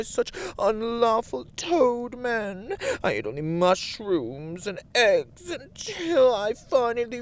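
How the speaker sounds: high, loud mock lament of a self-pitying diva; mock tragic diva. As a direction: parody a melodramatic sob story, overplayed. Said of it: "some sort of extravagant diva with a tragic backstory".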